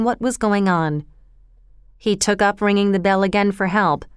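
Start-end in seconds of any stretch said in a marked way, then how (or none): none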